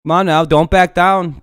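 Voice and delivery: taunting yell. Real, not acted. Taunting a troll on stream.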